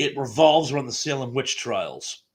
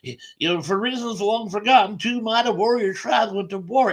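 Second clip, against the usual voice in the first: feeble Dustin Hoffman; 9 semitones higher, 5 dB louder than usual. Retold or imitated. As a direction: quaver it out like a frail old storyteller. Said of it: "some feeble Dustin Hoffman impression from Little Big Man".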